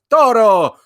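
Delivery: TORO high, loud, in a showy accent; put-on accent. Shown as a demonstration. Host showing Sam how to call the dog, put-on accent. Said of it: "give it an accent".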